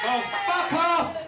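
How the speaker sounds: high, slow laughing mock outrage; hammed-up mock disgust. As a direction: sneer it high and stretched. Mock dismay at Scots.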